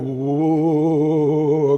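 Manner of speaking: long sung-out whoa of wonder; awed reverie. Retold or imitated. Man slipping into a reverie in the grocery aisle, dreamy awed whoa. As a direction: drift off into awe. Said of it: "kinda went into this reverie".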